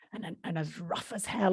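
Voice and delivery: put-on Yorkshire accent. [name] describing Marion, quiet Yorkshire accent. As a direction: add it quietly in mild Yorkshire. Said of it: "[name]'s the Yorkshire woman".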